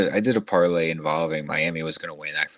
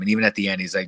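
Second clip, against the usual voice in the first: usual speaking voice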